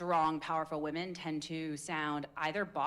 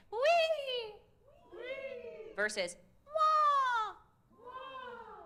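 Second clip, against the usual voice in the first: very high squealed wee then wah; much slower than usual. Shown as a demonstration. Coach compares high wee and wah vowels. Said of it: "smaller vowels are easier higher".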